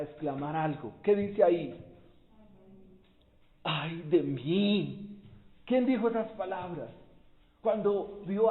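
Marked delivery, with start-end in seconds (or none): 3.6-5.1 lamento lento. Acted. Interjección leída en voz alta.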